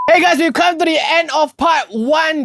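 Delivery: slow upbeat sign-off; outro announcement. Acted. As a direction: wrap up the episode brightly.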